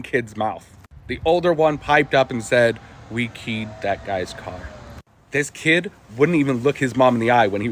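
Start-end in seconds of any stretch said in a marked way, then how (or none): 3.1-4.6 slow, soft confession, eyes on the floor; a scared kid owning up quietly; scared mumble. Retold or imitated. Scared kid confessing to angry mom.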